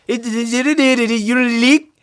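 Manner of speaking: put-on high, garbled French-accented jabber; mock French accent. Bandleader imitating the jazz snob woman, mock French accent.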